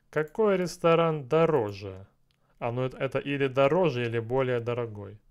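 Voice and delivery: ordinary delivery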